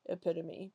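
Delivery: epitome said as ih-PIT-uh-mee; correct pronunciation. Shown as a demonstration. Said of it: "didn't know it was pronounced".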